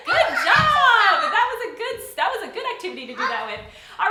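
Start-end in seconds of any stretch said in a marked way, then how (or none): 0.1-2.7 high, loud, laughing praise; excited praise. Real, not acted. Teacher cheering a child after the song, laughing loudly.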